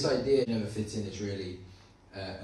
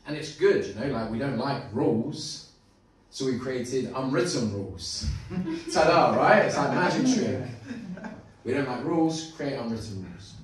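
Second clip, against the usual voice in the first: mock excited; 4 semitones higher, 8 dB louder than usual. Real, not acted. Ironic excited magic-trick bit.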